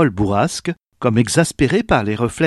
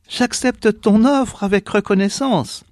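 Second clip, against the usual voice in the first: grateful mother; 9 semitones higher than usual. A narrator's reading. Mother accepts the old woman's offer, high and grateful.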